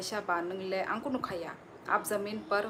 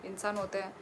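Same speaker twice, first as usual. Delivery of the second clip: normal talking voice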